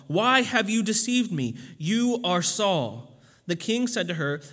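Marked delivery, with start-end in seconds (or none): 0.1-3.2 mildly raised, emphatic scripture reading; crying out loudly. A narrator's reading. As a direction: read the cry out a little louder and higher. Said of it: "cried out with a loud voice".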